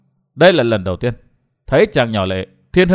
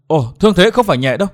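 mildly alarmed outburst; sudden exclamation; 3 semitones higher, faster than usual. A narrator's reading. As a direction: blurt it out in concern. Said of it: "bật kêu lên". (thốt lên lo lắng)

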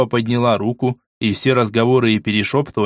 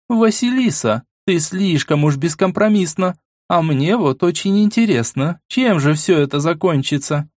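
much higher, curious woman; female voice; 6 semitones higher than usual. Acted. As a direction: play the curious woman lightly. Irina voiced high.

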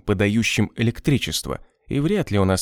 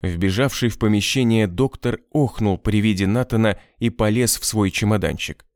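ordinary delivery

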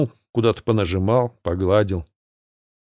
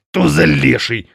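cursing; 3 semitones higher, 3 dB louder, slower than usual. A narrator's reading. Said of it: "выругался полузверь".

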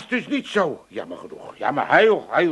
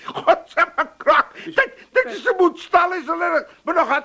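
laughing scorn; 13 semitones higher than usual. Acted. Old man laughing at son-in-law's bike plan, cackling scorn. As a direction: laugh him down.

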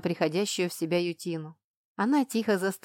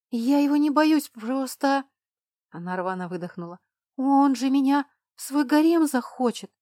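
high, loud tearful confession; a frightened girl confessing her fear, high and loud; sobbing plea; 7 semitones higher, 6 dB louder than usual. A narrator's reading. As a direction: choke it out near tears. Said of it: "всхлипнула она".

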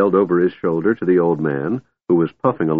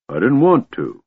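petulant, raised-pitch dragon retort; snorting dragon; 6 semitones higher than usual. A narrator's reading. Dragon defies the old man, snorting. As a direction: answer back with a mild snort. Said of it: "Pyrite snorted".

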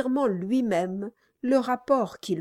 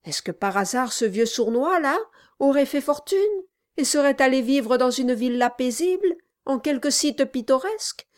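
sneering dwarf voice; 5 semitones higher, 5 dB louder than usual. Acted. Dwarf mocking the old man.

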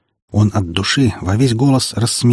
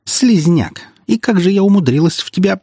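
contemptuous outburst; 9 semitones higher than usual. A narrator's reading. Girl spits insult at him.

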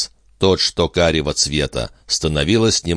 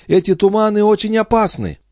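put-on high old landlady warning; a high, fussy old woman's voice. Landlady warns of fog.

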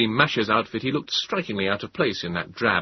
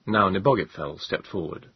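usual speaking voice

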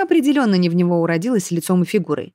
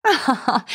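mocking laugh; 5 semitones higher than usual. A narrator's reading. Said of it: "и расхохоталась".

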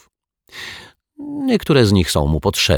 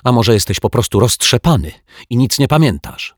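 higher, irritated comeback; angry; 6 semitones higher, 4 dB louder than usual. A narrator's reading. Zuzia turns on her scatterbrained brother, angry. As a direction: accuse with raised pitch. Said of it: "zezłościła się Zuzia".

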